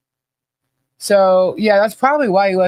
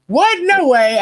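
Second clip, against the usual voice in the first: much higher, squeaky What, no way; stunned disbelief; 11 semitones higher, slower than usual. Retold or imitated. Husband reacting to chat's gift boxes, much higher in astonishment. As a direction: imitate his amazed double take.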